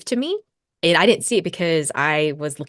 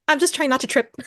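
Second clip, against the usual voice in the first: high innocent protest; 10 semitones higher than usual. Retold or imitated. Retold own innocent reply.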